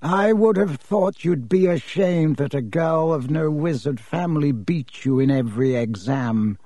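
ordinary delivery, nothing marked

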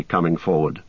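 usual speaking voice

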